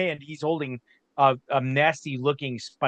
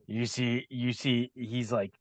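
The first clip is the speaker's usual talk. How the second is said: ordinary talk, nothing marked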